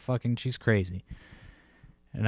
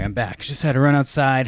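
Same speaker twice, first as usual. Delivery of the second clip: ordinary talk, nothing marked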